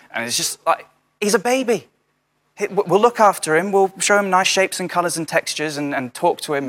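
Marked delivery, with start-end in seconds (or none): 1.2-6.7 exasperated protest. Retold or imitated. Exasperated reply to baby advice.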